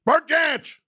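sudden yell. Real, not acted. Host randomly yelling folk people's names, sudden yell. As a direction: yell the name out, high and loud. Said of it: "Just started yelling folk people's names".

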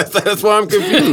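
laughing. Real, not acted. Laughing confusion.

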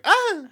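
high, loud cringe cry; mock squeal of discomfort; pained yelp. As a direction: yelp as if teeth just scraped. Acts out reaction to scraping teeth.

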